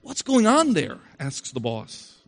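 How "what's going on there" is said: high, louder alarmed question. A narrator's reading. Boss on the phone, alarmed. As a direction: ask it in sudden worry. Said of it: "getting very alarmed now".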